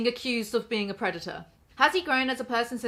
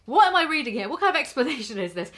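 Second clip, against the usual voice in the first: What, higher and louder, in real disbelief; exasperated outburst; 4 semitones higher, 5 dB louder, faster than usual. Real, not acted. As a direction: blurt it, incredulous. Repeated in disbelief.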